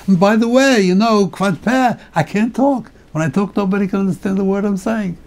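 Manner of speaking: Moshe's last objection. Retold or imitated. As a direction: toss it in casually at the end. Moshe finally adds his last objection.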